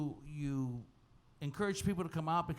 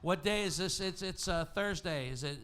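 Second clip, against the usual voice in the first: ordinary delivery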